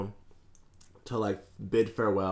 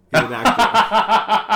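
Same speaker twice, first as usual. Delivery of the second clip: high, loud laughing protest; laughing defense; 13 semitones higher, 14 dB louder, much slower than usual. Real, not acted. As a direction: blurt it out loudly through laughter, drawn out. Defending an embarrassing crush amid laughter.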